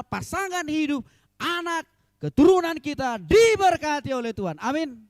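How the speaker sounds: high, loud, impassioned sermon delivery; rousing pulpit proclamation; preaching at full force. Real, not acted. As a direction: drive it home to the congregation, stretching key words. Preaches on the verse.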